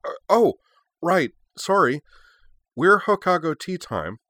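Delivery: high, loud, flustered schoolgirl; flustered girl voice. Acted. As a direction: blurts the intro again, embarrassed. Yui flustered, repeats intro loudly.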